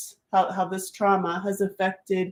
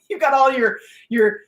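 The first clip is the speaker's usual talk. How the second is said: repeats it with a laugh, higher; 6 semitones higher, 3 dB louder than usual. Real, not acted. Coaching her audience, laughs mid-phrase. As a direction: let a laugh break in.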